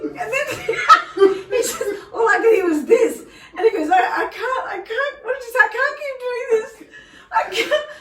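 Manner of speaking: high-pitched helpless laughter through words; can barely get it out for laughing; laughing hard. Real, not acted. Retelling son's prank, laughing hard.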